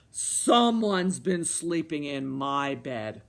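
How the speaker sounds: low, loud, slow bear; roaring Papa Bear. A narrator's reading. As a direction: roar it slowly, down low. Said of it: "roared Papa Bear".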